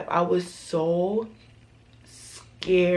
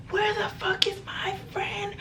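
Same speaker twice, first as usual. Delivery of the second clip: high-pitched mock scream, held back in volume; 4 dB quieter than usual. Cousin on a stranger's lawn demanding her friend, mock scream. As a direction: imitate a scream without the volume. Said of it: "screaming at the top of her fucking lungs".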